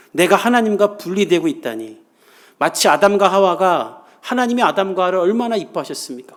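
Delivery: fervent sermon climax; impassioned preaching. Acted. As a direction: drive the message home from the pulpit.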